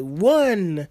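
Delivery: mock referee counting out loud; referee's count. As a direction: call it like a ref's count. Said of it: "the referee just counting".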